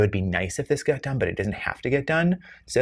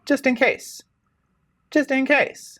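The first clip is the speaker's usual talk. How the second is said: squeaky high voice. Pretend. Playful repeated tag line.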